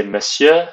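monsieur as "muh-syuh", silent n; French monsieur. Shown as a demonstration. Teacher modelling monsieur for students, French pronunciation. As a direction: monsieur with no n sound. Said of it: "the N is not pronounced".

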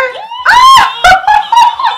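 very high, loud screaming laugh. Real, not acted. Vlogger laughing at the groom, screaming laugh.

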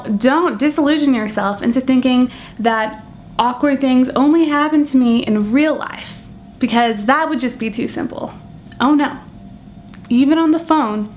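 ordinary delivery

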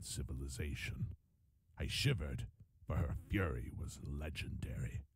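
put-on solemn old merchant. A narrator's reading. Old soldier recalls the queen's army to listeners, solemn and low. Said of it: "Tariku began solemnly".

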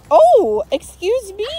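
high, loud playful scolding; mock indignation. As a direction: pretend outrage at a little thief. Playfully scolding someone taking the apple.

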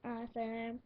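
ordinary talk, nothing marked

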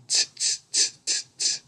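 short hissed "tss" noises; monkeys' tss-ing. A narrator's reading. Monkeys mimicking the peddler back at him, quick soft tss-ing. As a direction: tut like mischievous monkeys, all tongue and teeth. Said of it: "and said".

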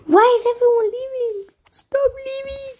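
very high plaintive whine; high, pleading cry to the others; high-pitched wail. Real, not acted. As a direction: wail it high, drawing out the last words. Players keep leaving the game.

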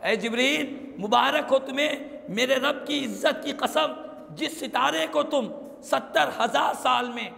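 ordinary delivery

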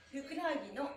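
usual speaking voice

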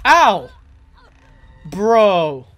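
loud high ow then protesting bro; pained outraged yelp. Real, not acted. Gamer hit by enemy, loud outraged yelp. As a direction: yell in pain and protest.

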